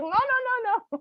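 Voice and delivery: high, loud panicky no's; mock scared guest; frightened refusal. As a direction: back away in fear. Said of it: "She's like".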